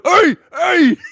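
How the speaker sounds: two high, loud "Hey!" cries; imitated yelps. Imitating Jason hit in the head, high yelps. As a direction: mimic a yelp from a knock on the head. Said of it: "you can hear him going".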